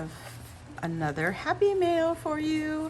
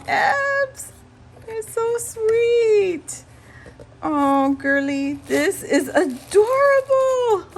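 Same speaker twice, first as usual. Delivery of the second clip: teary gushing; 8 semitones higher, 4 dB louder than usual. Real, not acted. Touched by gift, crying sounds.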